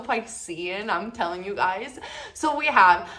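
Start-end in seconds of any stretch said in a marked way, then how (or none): none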